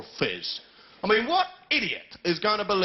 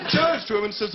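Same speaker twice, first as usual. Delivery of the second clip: ordinary delivery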